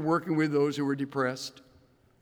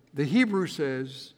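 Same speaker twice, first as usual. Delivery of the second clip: ordinary delivery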